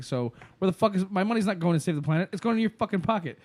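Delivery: high, fast, indignant tirade; mock rant. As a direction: rattle it off like he's calling out a scam. Retelling the Afterlife rant.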